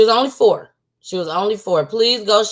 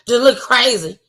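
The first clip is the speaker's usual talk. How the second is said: usual speaking voice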